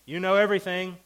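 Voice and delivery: God's sarcastic taunt, high and loud. Retold or imitated. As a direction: needle him with false praise. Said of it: "It's a sarcasm".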